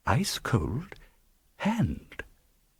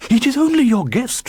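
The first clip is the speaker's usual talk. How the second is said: high, loud call from behind the panels; 7 dB louder than usual. A narrator's reading. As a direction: call out to reassure the startled host. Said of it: "I called out".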